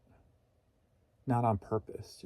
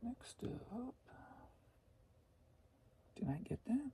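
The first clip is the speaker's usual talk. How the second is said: hushed unvoiced whisper, much higher; 12 semitones higher, 16 dB quieter than usual. Real, not acted. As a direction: whisper it to yourself. Whispering to herself.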